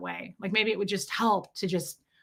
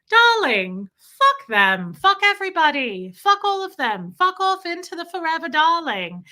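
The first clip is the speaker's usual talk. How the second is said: loud, high posh British Mary Poppins voice; cheerful nanny with a grand British accent, loud and high; 7 semitones higher, 6 dB louder than usual. Retold or imitated. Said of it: "It's like Mary Poppins".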